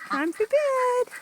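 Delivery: very high, slow sing-song call. Real, not acted. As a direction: draw it out high like coaxing pets. Calling ducks again, slower.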